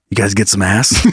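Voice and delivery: low creepy old man, lightly voiced; a hint of creepy old stranger; leaning-in old man. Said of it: "gets real close and he goes".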